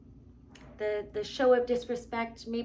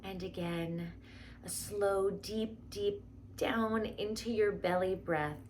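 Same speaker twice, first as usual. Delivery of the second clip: guided meditation; 3 semitones lower, 8 dB quieter than usual. Acted. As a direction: guide them gently into calm.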